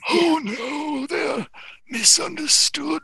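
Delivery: very high, slow eerie character voice; otherworldly high voice, words stretched; Barmy. Acted. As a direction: intone it high and drawn out. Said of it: "Barmy says".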